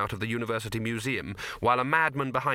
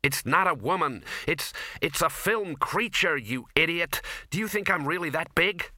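higher indignant retort; a mild mock shout, exasperated; 5 semitones higher than usual. Ginger shouting back at the dean, indignant higher voice. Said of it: "shouted Ginger".

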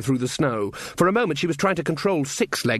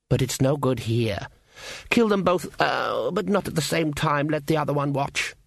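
haughty elf Queen, coolly amused; 2 semitones higher than usual. Acted. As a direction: toy with a beaten rival, then casually order death. The Queen mocks, orders killing.

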